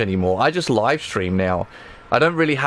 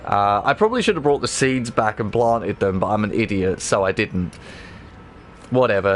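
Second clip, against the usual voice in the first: ordinary delivery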